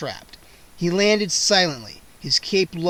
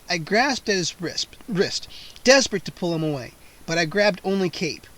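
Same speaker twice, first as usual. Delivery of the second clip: usual speaking voice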